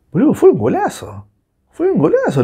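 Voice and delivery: high, exclaimed amazement; admiración exaltada. Real, not acted. Admits rival's superb goal. (exclamación aguda de asombro)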